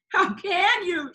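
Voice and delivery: sobbing reproach. A narrator's reading. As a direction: reproach him through tears. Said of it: "she sobbed".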